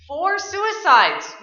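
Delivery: slow, loud, high on Four; grave emphasis. Acted. Agent stating the grim fact into the mic, slow and loud. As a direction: lean hard on "Four suicides".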